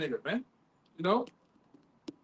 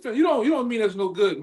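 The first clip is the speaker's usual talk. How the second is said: ordinary talk, nothing marked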